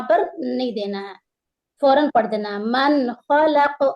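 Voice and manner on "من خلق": من with the ن fully pronounced and quickly released, not nasalised; نون کا اظہار; clear nun. Shown as a demonstration. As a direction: idhar on من خلق. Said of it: "ناک میں چھپا کر نہیں پڑھیں گے اور جلدی".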